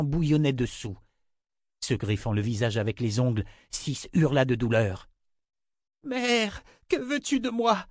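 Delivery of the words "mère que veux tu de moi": very high, distressed cry; anguished plea. Acted. Six in agony to her mother, pleading. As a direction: plead in pain, high-pitched.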